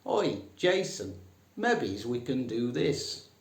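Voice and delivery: high, blunt Northern call "Oi, Jason"; lightly hammed Northern manager; Northern boardroom. Shown as a demonstration. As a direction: call across the table in broad Northern. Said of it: "That's mebbies".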